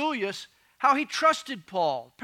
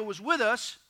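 ordinary talk, nothing marked

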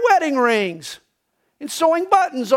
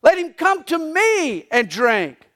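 high, slow ringing call, much higher; preacher's shout; 6 semitones higher, slower than usual. Retold or imitated. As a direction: cry it out from the back of the temple. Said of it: "he shouted".

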